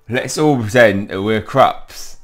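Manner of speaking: loud, slow playful invitation; eager excitement. Acted. Friend proposing to play as creatures, eagerly excited. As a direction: announce the game with gusto.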